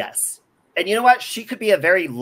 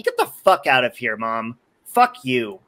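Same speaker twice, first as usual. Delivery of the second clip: angry; 7 semitones lower than usual. Real, not acted. Cussing out mom on stream.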